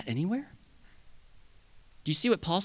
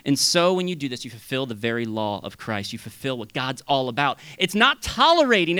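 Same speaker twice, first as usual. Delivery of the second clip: usual speaking voice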